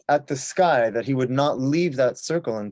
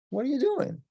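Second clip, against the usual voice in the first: character voice; 13 semitones higher than usual. Acted. Choni voiced in told story.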